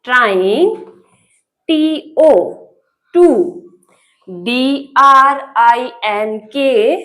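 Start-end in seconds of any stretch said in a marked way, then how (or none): 1.7-7.0 letters said slowly one by one; letter-by-letter spelling for a child; spelled out. Shown as a demonstration. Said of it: "क्या लिखा हुआ है?".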